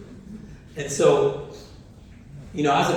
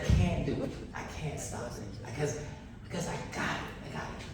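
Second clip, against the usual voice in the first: much softer, near-whispered resolve; hushed to himself; 3 semitones lower, 12 dB quieter, faster than usual. Retold or imitated. Dad stopping himself from intervening, hushed near-whisper. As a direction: hold back and say it under your breath. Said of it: "but I said".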